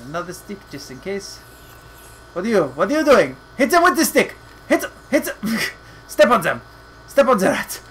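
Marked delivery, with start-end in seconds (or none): 2.3-7.8 loud, high, fast urging; frantic loud commands at the screen; excited yelling. Real, not acted. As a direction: egg the character on to whack them. Urging character to hit rats.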